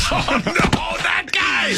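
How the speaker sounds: high-pitched cry of comic dismay; hammed-up outcry; mock alarmed shout. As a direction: shout it high like a panicked onlooker. Mock crowd reaction to the callback.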